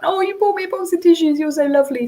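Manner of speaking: put-on sweet delighted praise for a kind child; delighted thanks. Shown as a demonstration. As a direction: gush with gratitude to the child. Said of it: "This is what you have to say".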